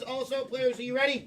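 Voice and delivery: loud, high game-show call; rally the contestants; hyping up. Real, not acted. Host hypes up the players.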